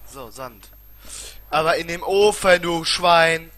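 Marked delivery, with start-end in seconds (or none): 1.5-3.5 loud, high insult; angry cursing. Real, not acted. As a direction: yell at the oven. Cursing at the oven. (lautes Fluchen)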